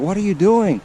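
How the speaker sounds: high, loud frightened shriek; panicked scream. Retold or imitated. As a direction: yell it in alarm. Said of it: "She's screaming, yelling".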